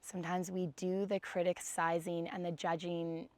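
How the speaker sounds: gentle guided meditation read. Acted. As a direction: ease the class into stillness. Leads seated breathing meditation.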